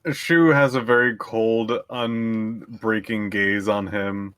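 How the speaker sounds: normal talking voice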